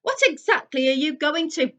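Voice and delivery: high, loud bellowed demand cut off; bellowing. A narrator's reading. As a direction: roar a demand before the blast. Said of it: "he bellowed at the fire".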